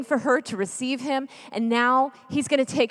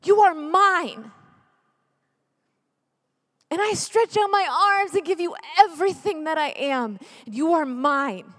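fervent declaration; 4 semitones higher, 3 dB louder than usual. Acted. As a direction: claim them with each word held out. Voices Jesus claiming the sufferer.

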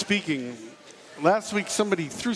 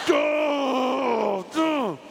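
long, high-pitched groan of pain; hammed-up drawn-out cry of agony; agonized groan; 7 semitones higher than usual. Acting out kidney stone agony.

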